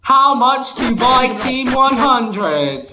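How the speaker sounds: slow, high robotic read; text to speech voice. Pretend. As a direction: read it out like a TTS bot. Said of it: "I'll read it in a text to speech voice".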